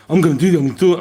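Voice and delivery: high, loud insistent recruit; a mock frantic recruit pleading; recruit insisting. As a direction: blurt it out like a rattled young soldier. Recruit quoted insisting he'll self-harm.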